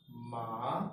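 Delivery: ma drawn out and climbing from low; rising second tone. Shown as a demonstration. Teacher showing how the second tone rises, rising ma. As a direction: slide ma upward like a question. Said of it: "it rises as I say it".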